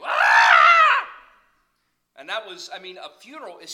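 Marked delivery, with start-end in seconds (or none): 0.0-1.1 a huge high wailing cry of grief; mourning wail. Retold or imitated. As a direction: wail out loud over the body, hammed up. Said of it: "walk by the body and go".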